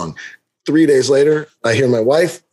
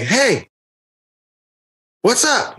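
calling out; 9 semitones higher, much slower than usual. Retold or imitated. Homeowner to man walking away, high retold call. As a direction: call after him with a pause between.

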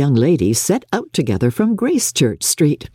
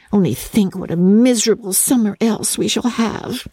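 put-on higher, eager girlish gush; gushing Lydia; 8 semitones higher than usual. A narrator's reading. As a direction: pour it out like a giddy girl. Lydia gushing about Brighton.

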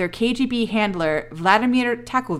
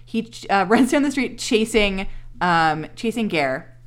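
ordinary talk

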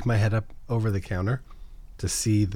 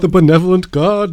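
excited; 6 semitones higher, 10 dB louder than usual. Real, not acted. Hyping the DM loudly.

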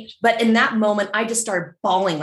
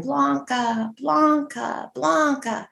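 ghostly calling; 5 semitones higher, much slower than usual. Retold or imitated. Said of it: "they just kept hearing".

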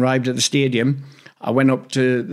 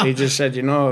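usual speaking voice

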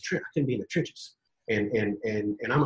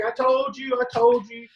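smug told-you-so; 9 semitones higher than usual. Retold or imitated. Lee gloating to Billy.

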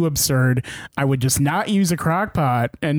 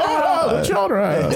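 Jimmy Stewart; 12 semitones higher, slower than usual. Acted. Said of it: "I love Jimmy Stewart as a fire elemental".

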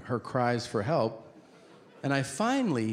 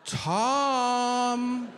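high mock wife calling his name; 10 semitones higher than usual. Wife calling husband at night, high mock call. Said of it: "she starts calling me out by name".

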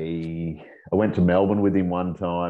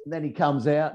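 ordinary delivery

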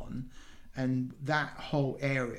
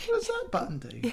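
high, playful put-on voice asking; mock curious kid; 10 semitones higher, faster than usual. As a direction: ham it up like a nosy child at the controls. Joking repeat of sub question.

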